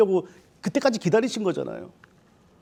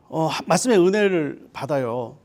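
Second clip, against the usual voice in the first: normal talking voice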